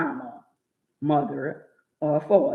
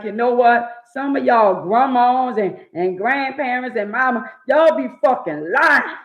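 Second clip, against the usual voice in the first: building retold rant; 8 semitones higher, 6 dB louder than usual. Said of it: "And I be like".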